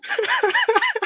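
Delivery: squealing burst of laughter; sudden high-pitched laugh. Real, not acted. Laugh after outburst.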